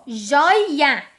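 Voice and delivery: JOIA broken as "joi... a"; split into syllables. Shown as a demonstration. Teacher showing the last vowel separated, split syllables. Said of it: "mais uma separada".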